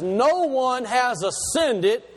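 slow, deliberate reading with stress on "No"; slow emphasis. Shown as a demonstration. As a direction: lean on "No one" slowly, like a preacher. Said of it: "I'm gonna say it slow".

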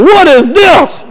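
half-laughing disbelief at the mess; exasperated parent. Retold or imitated. Dad holding up his messy kids, baffled.

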